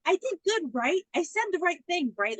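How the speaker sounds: put-on high, hopeful girl asking for approval; eager naive girl. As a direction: fish for praise like a child. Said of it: "she's like".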